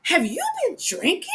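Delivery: high, suspicious lady voice; alarmed lady. Retold or imitated. Lady turning from concern to suspicion, high suspicious voice. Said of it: "it turns from concern to alert".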